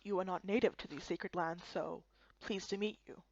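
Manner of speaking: low, soft, grave elder voice; solemn old Kappa. Acted. Old Kappa elder welcoming foreigners, low and soft. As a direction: speak like an ancient guardian of sacred lands.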